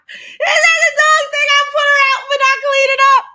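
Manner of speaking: cracking up mid-question; laughing. Real, not acted. Laughing at the dog story.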